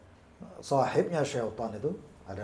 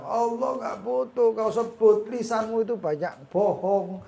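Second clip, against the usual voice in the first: stretched, raised-voice reproach; devil's reproach; 6 semitones higher, 6 dB louder than usual. Retold or imitated. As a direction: draw out each accusation loudly. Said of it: "Nanti kadang-kadang kita dibilang".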